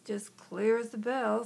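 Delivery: ordinary delivery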